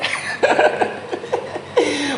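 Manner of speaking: high-pitched burst of real laughter; breathy, amused laughing fit. Real, not acted. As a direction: crack up mid-lesson. Amused at over-long translation.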